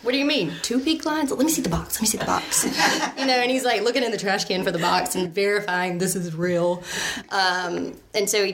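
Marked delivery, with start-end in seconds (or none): 0.0-2.7 higher, startled, laughing disbelief; imitating a stunned husband; mock disbelief. Husband checking the pink lines, in laughing disbelief.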